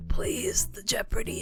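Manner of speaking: normal talking voice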